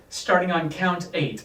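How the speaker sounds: ordinary talk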